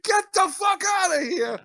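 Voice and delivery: high-pitched shouted dismissal; imitated indignant shout; retold yell. Retold friend yelling from shower.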